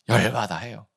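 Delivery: ordinary talk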